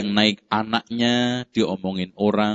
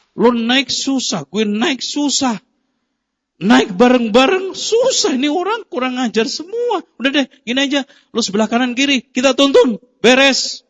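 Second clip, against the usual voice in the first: exasperated father; 12 semitones higher, 4 dB louder than usual. Retold or imitated. Father to son, fed up with gossip, high exasperated rant. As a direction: throw up your hands at the gossip.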